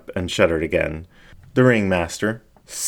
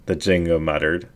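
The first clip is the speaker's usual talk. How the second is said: usual speaking voice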